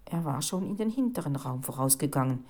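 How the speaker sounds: plain reading voice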